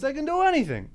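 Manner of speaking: normal talking voice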